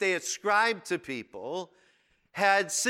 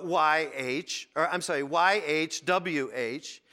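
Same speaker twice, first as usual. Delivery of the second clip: ordinary delivery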